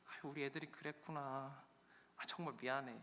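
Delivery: soft, subdued acted sorry; hushed, conciliatory reply; meek apology. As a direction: apologise quietly to a sister. Acted sister apologises meekly.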